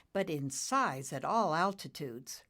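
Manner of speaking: usual speaking voice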